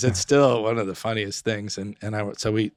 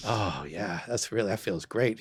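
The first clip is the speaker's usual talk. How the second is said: imitated moaning pleasure; 10 dB quieter than usual. Patient pretending to feel the sponge bath, moaning contentedly. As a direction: sink into it with a quiet mock moan. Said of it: "kind of moaning sounds".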